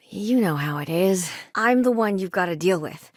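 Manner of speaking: usual speaking voice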